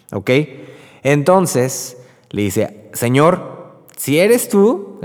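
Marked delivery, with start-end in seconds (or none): 2.9-5.0 mock doubtful Peter. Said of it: "yo me imagino así también como de broma".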